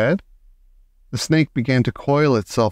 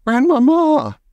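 high, loud desperate call; boy shouting again; 13 semitones higher, 4 dB louder, slower than usual. A narrator's reading. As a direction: shout her name with rising fear. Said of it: "I shouted again".

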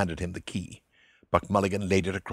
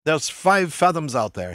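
a gruff boatman, pitched up and louder; boatman's voice; 7 semitones higher, 9 dB louder than usual. A narrator's reading. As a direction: read the boatman's line with a louder, raised voice. Boatman quoted in read story.

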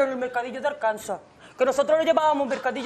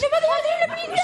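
excited squeal; 12 semitones higher, faster than usual. Acted. Over-excited about Hollywood.

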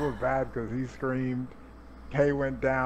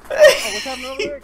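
very high, loud, fast squeaky voice; fast squeaky mock; 11 dB louder, much faster than usual. Mocking a confused teammate, high fast squeak.

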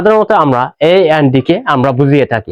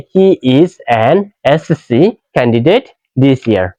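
slow model sentence; 3 semitones lower, much slower than usual. Shown as a demonstration. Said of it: "slow model sentence".

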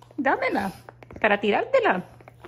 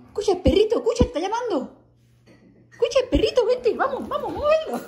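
high excited pet voice; 8 semitones higher than usual. Real, not acted. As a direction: rile up the puppy in sing-song. Excited baby talk to dog.

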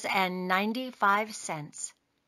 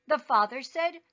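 ordinary delivery, nothing marked

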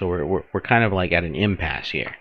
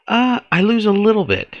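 high, squeaky whine about losing; mock whine; 14 semitones higher, 5 dB louder than usual. Player whining over lost influence in a much higher voice. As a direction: whimper the loss in a high voice.

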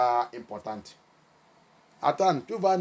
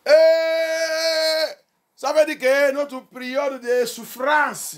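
long high, loud "Eh", then drawn-out explanation; raised pulpit voice, stretched out; emphatic exclamation; 11 semitones higher, 6 dB louder, much slower than usual. Real, not acted. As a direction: cry out, then preach it slowly. Preacher exclaims after scripture reading.

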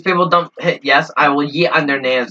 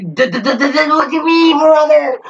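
very high, loud, slow cartoon cry; mock outraged crying yell; hammed-up meme wail; 12 semitones higher, 4 dB louder than usual. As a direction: whine it out like a meme. Escalating meme catchphrase.

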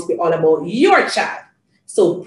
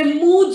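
short, higher moo; cow moo; 7 semitones higher, 4 dB louder than usual. Shown as a demonstration. Asking Janie to say it, short moo. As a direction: give a quick demonstrating moo. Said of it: "what does the cow say?".

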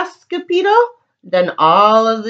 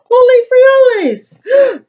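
much higher, louder startled shout; startled cry; 6 dB louder than usual. Acted. The sword fighter faces the giant bumblebee, startled shout. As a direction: yelp it as the bandit looms in his face. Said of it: "cried Scipio".

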